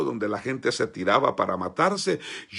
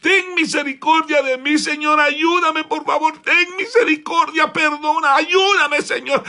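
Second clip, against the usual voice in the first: high, loud tearful pleading to God; crying prayer; 12 semitones higher, 7 dB louder than usual. Retold or imitated. Boy at the altar begging God, crying out loud. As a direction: beg God for mercy through tears. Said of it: "comencé a llorar y a decirle".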